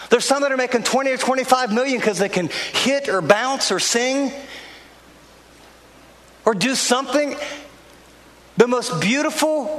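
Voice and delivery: high-pitched, driving pulpit voice; impassioned preaching. Acted. Pastor rousing his congregation with high, urgent preaching.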